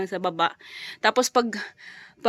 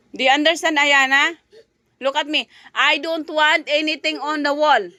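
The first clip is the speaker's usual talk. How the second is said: stern scolding; 5 semitones higher, 5 dB louder than usual. Real, not acted. Mother lecturing crying daughter about the wall, stern and loud. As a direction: lay down the law firmly, word by word.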